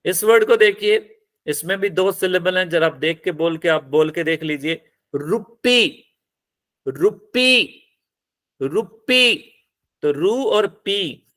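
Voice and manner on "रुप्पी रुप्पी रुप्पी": रुप्पी with a higher, held पी; short रु, then long high पी in रुप्पी; stress on last syllable. Shown as a demonstration. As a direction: punch the पी in रुप्पी. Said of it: "तो stress किस पे लगेगा?".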